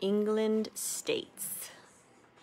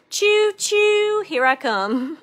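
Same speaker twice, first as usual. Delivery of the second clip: high, loud choo choo; hamming up a train whistle; mock train conductor call; playful train call; 13 semitones higher, 8 dB louder, slower than usual.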